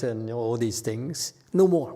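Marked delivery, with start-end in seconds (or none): 1.5-1.9 "No more" shouted out higher and louder, falling hard on "No"; loud, higher exclaimed "No more"; emphatic "No more". Real, not acted. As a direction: punch out "No more" like a firm declaration. Repeats renouncing with force.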